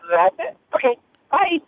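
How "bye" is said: usual speaking voice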